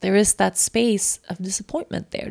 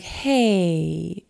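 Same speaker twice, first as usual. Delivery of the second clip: subdued hey. Retold or imitated. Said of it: "I hear the voice like".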